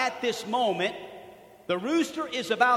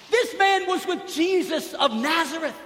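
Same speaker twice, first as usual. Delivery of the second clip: high, louder pointing-out voice; second servant girl; 8 semitones higher, 5 dB louder than usual. Retold or imitated. Preacher voicing the second servant girl exposing Peter to the bystanders, high and loud. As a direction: call him out to the crowd. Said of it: "she said to the bystanders".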